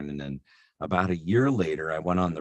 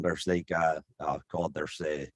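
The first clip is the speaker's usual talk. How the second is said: Irish lilt; Irish accent. Shown as a demonstration. Voice actor touring the British Isles, Irish accent. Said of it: "if you go to Ireland".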